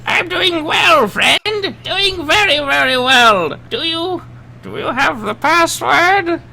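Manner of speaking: very high, slow and loud; drawn-out squeaky friendly voice; high cheerful voice. Acted. Cheerful NPC voice through stone.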